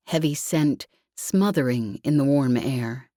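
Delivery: usual speaking voice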